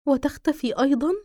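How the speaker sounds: usual speaking voice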